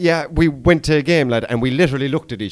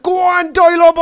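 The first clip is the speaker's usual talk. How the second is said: much higher and louder cheer; excited goal cheer; 8 dB louder than usual. Retold or imitated. Cheering Kevin Doyle's goal among Vancouver fans, loud high yell. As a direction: yell it as the equaliser goes in.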